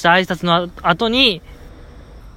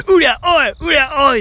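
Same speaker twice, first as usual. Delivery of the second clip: lone spirited call; 8 semitones higher than usual. Retold or imitated. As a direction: shout the call out alone, full of gusto. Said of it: "一人だけ".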